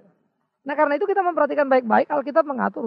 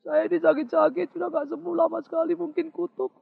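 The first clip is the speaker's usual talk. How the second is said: higher, whiny imitation of a sick believer; whiny complainer; 5 semitones higher than usual. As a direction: whine that it must be a curse. Congregant blames a curse.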